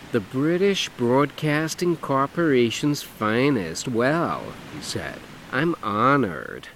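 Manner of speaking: flamboyant man. Acted. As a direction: camp it up, lilting and showy. Dougie voiced in story.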